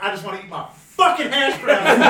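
laughing mock whine. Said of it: "Terrell was like".

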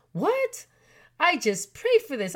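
a high, sharp "What?" of disbelief; stunned what. Retold or imitated. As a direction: jump way up in sudden surprise. Mother stunned by tulip gift.

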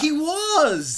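high, loud exclamation; excited agreement. Real, not acted. Host agrees about the actor's appearance, excited agreement. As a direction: exclaim it, voice leaping up.

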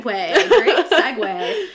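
slow, loud stretched laugh; drawn-out laughing. Shown as a demonstration. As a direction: drag it out laughing.

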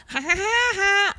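high mechanical doll laugh; chirpy talking doll laughing; pull string toy. Retold or imitated. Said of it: "he says something like".

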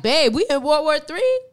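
retold alarmed "wait, what?" voice; startled question. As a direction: blurt it out in disbelief to a partner. Retelling startled question to partner.

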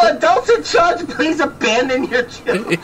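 laughing through a fake PA call; giggling intercom parody; laughing mock announcement.

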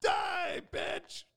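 very high, loud shrieking yell; mock yell. To the suffering teammate, a high mock shriek. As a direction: shriek it theatrically at him. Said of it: "I was like".

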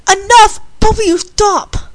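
high, loud order to stop; stern scolding. Acted. Miss Pony to two fighting children, stern scolding.

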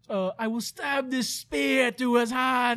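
slow, stilted fake British line; mock British accent. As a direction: drag out a hammy British line. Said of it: "She's like".

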